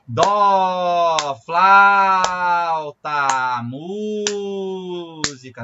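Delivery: very slow, loud drawn-out syllables, then softening; overstretched syllables. Shown as a demonstration. Music teacher showing another wrong way, syllables stretched out too long. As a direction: drag each word far beyond its beat. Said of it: "E nem".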